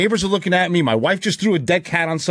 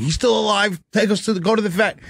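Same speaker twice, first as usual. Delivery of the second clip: ordinary talk, nothing marked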